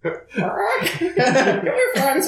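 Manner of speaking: high squawky parrot voice. Acted. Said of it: "now I gotta do that".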